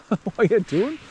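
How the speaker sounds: amused drawn-out dog talk; laughing at the dog. Real, not acted. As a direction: chuckle it out slowly at the silly dog. Dog acting up.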